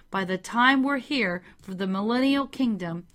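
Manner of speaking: normal talking voice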